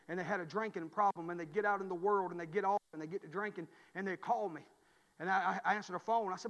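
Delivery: ordinary talk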